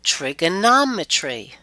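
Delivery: "trigonometry" with NOM stressed and lengthened; stress before suffix. Shown as a demonstration. Teacher shows the rule, "trigonometry" stressed on NOM. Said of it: "we stress right before it".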